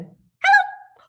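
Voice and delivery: clipped quick high hello; fast hello. Pretend. Puppet Henry greets the kids, quick hello. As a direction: snap out the hello. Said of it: "say hello as fast as we can".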